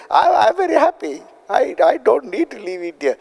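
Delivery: high, pleading protest. Retold or imitated. Refusing to leave India, pleading high protest. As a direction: beg to stay put.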